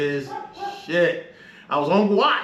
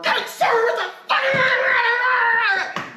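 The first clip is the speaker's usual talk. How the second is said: much higher, slow, laughing shout; sailor's retold outburst after the door closes, laughing shout; 4 dB louder, much slower than usual. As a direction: burst out in disbelief, laughing. Outburst after leaving office.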